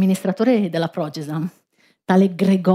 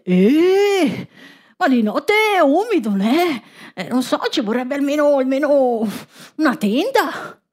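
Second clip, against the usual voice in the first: worried woman; 5 semitones higher than usual. Acted. Woman worries about the night.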